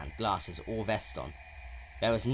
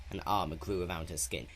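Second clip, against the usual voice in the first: ordinary delivery, nothing marked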